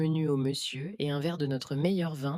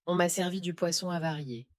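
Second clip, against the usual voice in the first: plain reading voice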